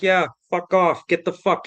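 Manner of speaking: high-pitched, defiant send-off; retold angry dismissal. He orders trespassers out of the garage, angry dismissal.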